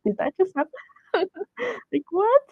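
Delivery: high-pitched, laughing disbelief; re-enacted starstruck bewilderment; stunned disbelief. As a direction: ask it giddily, unable to believe it. Reliving shocked disbelief with laugh.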